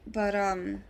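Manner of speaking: ordinary delivery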